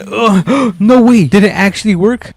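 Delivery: high gasp, can't believe it; gasp, then ask in disbelief; surprised gasp. Real, not acted. Truck pulls free second try.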